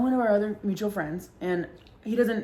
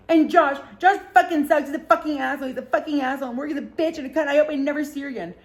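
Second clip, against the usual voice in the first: high, tumbling tirade; worked-up mock outrage, starting loud; mock furious rant; 6 semitones higher, 4 dB louder, faster than usual. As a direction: imitate a friend ranting insults down the phone. Friend's phone rant, continued.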